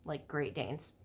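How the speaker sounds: usual speaking voice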